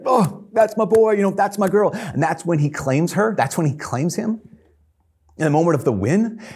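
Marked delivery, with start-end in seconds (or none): 0.0-1.9 tearful proud dad. Retold or imitated. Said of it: "he's, like, crying".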